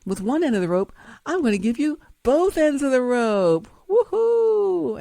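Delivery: rising to a loud, very high woohoo; fake gleeful cheer. Fake person gleefully offering both rope ends, loud high whoop. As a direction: whoop it up like a false friend. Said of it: "the fake person says".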